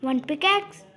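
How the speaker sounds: ordinary delivery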